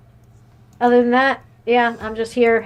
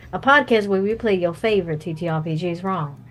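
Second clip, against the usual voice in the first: normal talking voice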